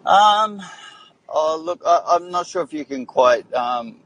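high, shaky voice while jolted; talking while being shaken about; shaky, on a bumpy road. Real, not acted. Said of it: "sorry about the shaking".

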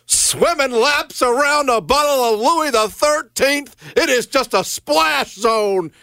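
high, drawn-out play-by-play shout; Mark Jones. Retold or imitated. Co-host mimicking an announcer's rap-style call, high and drawn out. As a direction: imitate a hyped-up announcer's stretched-out call. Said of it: "again, in his tone, he'll be like".

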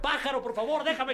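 soaked joke monkey pleading, high and laughing. Acted. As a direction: beg the bird hammily, half laughing. Monkey begs bird in joke.

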